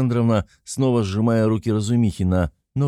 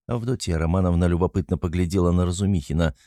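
ordinary delivery, nothing marked

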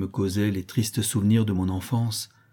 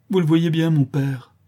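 pained cry, pitched up; Fleur de Marie, sorrowful; 6 semitones higher, 4 dB louder than usual. A narrator's reading. Despairing young woman to the priest, sorrowful cry. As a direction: exclaim in sorrow, voice lifted. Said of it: "s'écria douloureusement Fleur de Marie".

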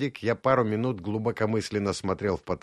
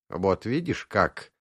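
ordinary delivery

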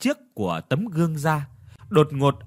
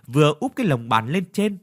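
usual speaking voice

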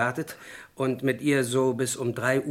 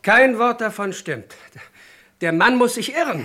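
indignant denial; 7 semitones higher, 5 dB louder than usual. Acted. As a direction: insist hotly that it is all lies. Suspect denies accusation to commissioner.

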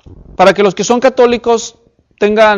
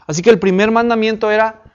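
usual speaking voice

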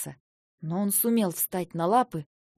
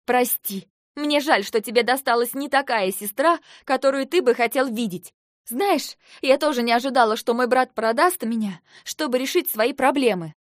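higher, louder, heated sarcasm; bitter reproach; 3 semitones higher, 5 dB louder than usual. Acted. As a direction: hurl a mock apology at a brother. Sister bitterly reproaches her brother. (горький, язвительный упрёк)

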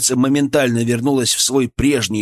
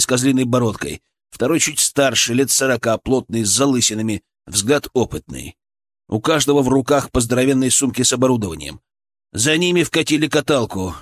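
plain reading voice

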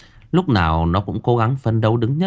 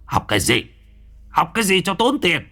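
angry father; 8 semitones higher than usual. Acted. Drunk father scolding wife.

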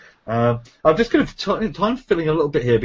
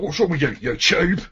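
Cockney accent; 3 semitones higher than usual. Shown as a demonstration. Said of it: "it's like".

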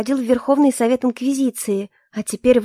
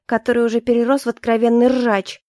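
ordinary delivery, nothing marked

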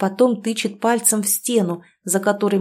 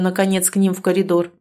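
plain reading voice

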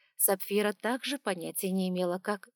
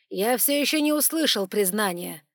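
mocking; 8 dB louder than usual. A narrator's reading. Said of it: "насмешливо бросил Архонт".

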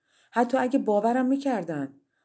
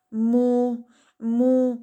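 wailing mu, higher and louder; plaintive shout; 5 semitones higher, 7 dB louder, much slower than usual. A narrator's reading. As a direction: cry the lost word in despair. (فریاد سوزناک «مو»)

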